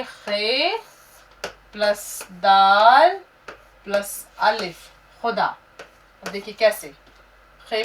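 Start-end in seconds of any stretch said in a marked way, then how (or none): 0.0-5.6 slow pronunciation. Shown as a demonstration. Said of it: "उच्चारण भी सही रखना है".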